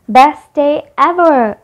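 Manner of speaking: loud, high, drawn-out exclamation; overjoyed. A narrator's reading. Boy delighted by fish and chips for lunch, overjoyed. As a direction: stretch it out with delight.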